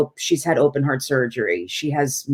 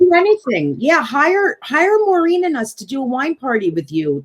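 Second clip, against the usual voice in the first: excited; 8 semitones higher than usual. Real, not acted. Eager pitch for paid wine parties.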